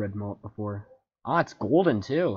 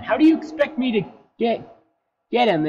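frustrated; 11 semitones higher, 7 dB louder than usual. Real, not acted. Frustrated at fish.

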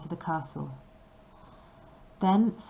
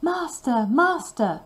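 much higher cry; harp crying out; 7 semitones higher, 5 dB louder, slower than usual. A narrator's reading. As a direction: call out high and urgent. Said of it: "the harp continued to cry".

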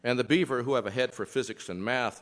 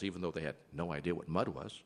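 ordinary delivery, nothing marked

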